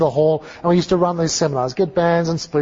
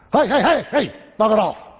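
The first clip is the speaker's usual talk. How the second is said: higher, sharp call to order; irritated interruption; 6 semitones higher than usual. Retold or imitated. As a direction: cut in to stop the chatter. Preacher interrupts rowdy students.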